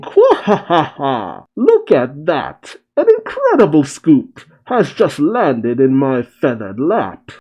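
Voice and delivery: slow, booming squawk of a laugh; gloating Big News Morgan. Acted. Morgan celebrates a scoop landing in his lap, theatrical gloating. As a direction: crow over it theatrically, stretching the words out.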